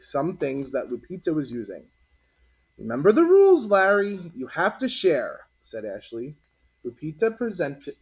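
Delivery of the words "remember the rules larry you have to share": put-on louder, higher bossy kid; a girl reminding a boy to share; bossy reminder. A narrator's reading. Girl scolds Larry.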